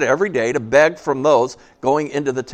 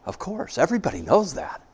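shuddering in fear; 5 semitones lower, 8 dB quieter than usual. A narrator's reading. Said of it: "shuddering in fear".

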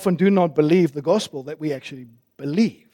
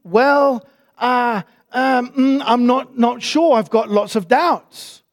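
higher, halting hesitant voice; unsure believer; 4 semitones higher, much slower than usual. Retold or imitated. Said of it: "And very the answer comes back".

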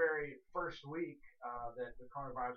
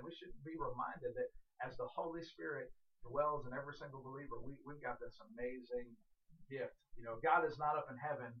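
normal talking voice

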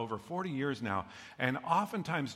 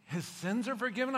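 much higher, rising "forgiven?" in disbelief; incredulous echo; 9 semitones higher than usual. Acted. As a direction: repeat it as if you can't believe it.

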